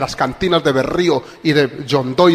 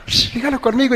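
hushed, higher mock worship-leader voice; 7 semitones higher, 4 dB quieter than usual. Preacher mocking a worship leader leading the song, hushed and higher. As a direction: lightly mimic a soft worship leader coaxing the crowd. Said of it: "al que quiere imitar a [name]".

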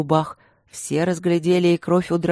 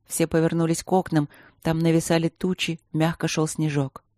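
plain reading voice

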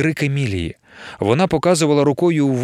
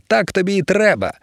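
gloating; 6 semitones higher than usual. Acted. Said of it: "тішилася Емілія".

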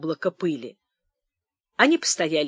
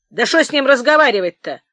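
usual speaking voice

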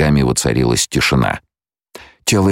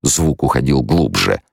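ordinary delivery, nothing marked

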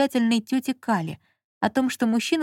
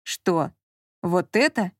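mildly puzzled question; surprised; 2 semitones lower, slower than usual. A narrator's reading. Marquis is handed a magazine by Lola, mildly surprised. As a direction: ask with understated disbelief. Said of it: "удивлённо проговорил маркиз".

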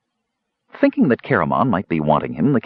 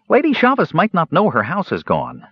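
high, earnest kender worry; a helpful kender, pitched up; 9 semitones higher than usual. A narrator's reading. Kender Earwig worrying about Lady Chavez, high and earnest.

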